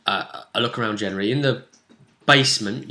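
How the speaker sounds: ordinary talk, nothing marked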